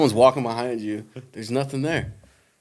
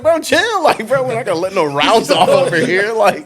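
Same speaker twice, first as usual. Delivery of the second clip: higher pitched, laughing retold plea to a buddy; urgent plea; 8 semitones higher, 4 dB louder than usual. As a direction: beg him to put the gun down, half laughing. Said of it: "I was like".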